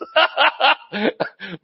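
high burst of laughter; laughing. Real, not acted. Preacher laughing at his own confession, amused laugh. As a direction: crack up at your own joke. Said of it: "But I'm just laughing".

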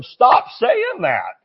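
retold exasperated order; exasperated scolding. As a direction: tell him off, drawing it out. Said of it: "I finally said to him".

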